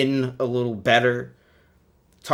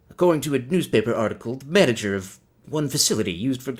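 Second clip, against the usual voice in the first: lightly voiced starship captain reading aloud; a hint of dramatic captain with odd phrasing; Captain Kirk; 2 semitones higher than usual. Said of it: "he pauses weird, like me".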